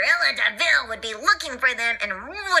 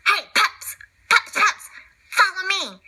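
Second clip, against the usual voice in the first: cat voice; 5 semitones higher than usual. Acted. Cat calls the puppies.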